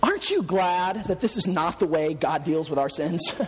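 usual speaking voice

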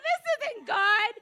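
high, loud, drawn-out laughing protest; retold out-loud protest. Believer debating God out loud, loud mock protest. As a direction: call it out slowly, hammed-up denial. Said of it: "I said out loud back to him".

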